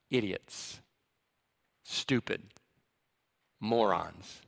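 soft, low insults, one at a time; dismissive contempt. Acted. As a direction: drop each word quietly with disdain. Acting out Pharisees' dismissive insults.

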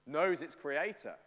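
ordinary talk

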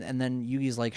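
ordinary talk

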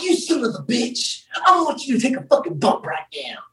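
half-whispered threat, high at first; a playful whispered dare to take a bump; menacing threat. Retold or imitated. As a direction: hiss it like a mock challenge. Friend threatens him on dancefloor.

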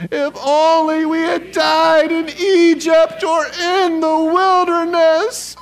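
high, loud, drawn-out group whine; whiny voice. Acted. Preacher leading the congregation in verse, whiny voice. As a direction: whine it out slowly in an exaggerated, high-pitched complaint. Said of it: "your best whiny voice".

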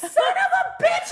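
high, loud comic exasperation; retold fury. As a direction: relive being livid, with laughter in it. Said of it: "I was so fucking pissed".

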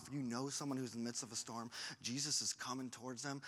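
ordinary talk, nothing marked

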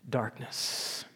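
hushed. Acted. Reading of thick darkness.

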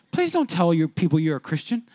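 ordinary talk, nothing marked

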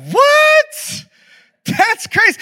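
very high, squeaky mock shock; feigned disbelief. Caught out by his roommate, high fake surprise. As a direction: badly fake innocence way up high.

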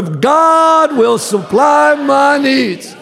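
slow, shouted declaration. Shown as a demonstration. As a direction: belt it out to the congregation. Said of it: "Say it louder".

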